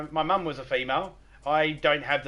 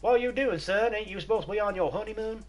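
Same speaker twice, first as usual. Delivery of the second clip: high, hammed-up bad American accent as the dad; a mock Southern American dad, higher; 8 semitones higher than usual. Dad questioning his son home early, in a bad American accent. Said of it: "I'm doing his bad American accents".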